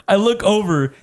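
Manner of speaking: ordinary talk, nothing marked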